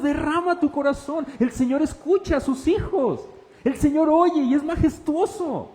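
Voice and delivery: high, loud, impassioned exhortation; fervent pulpit climax; preaching at full force. Acted. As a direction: urge them to pour out their hearts. Pastor exhorts congregation to pour out hearts.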